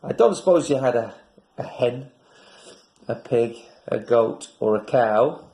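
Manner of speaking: ordinary delivery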